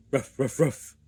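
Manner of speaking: loud high woof woof woof; dog barks. A narrator's reading. Scruffy barking outside the museum, loud and high. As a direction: bark like a tied-up puppy. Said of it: "barked Scruffy".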